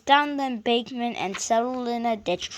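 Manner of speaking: plain reading voice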